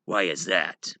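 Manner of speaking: ordinary talk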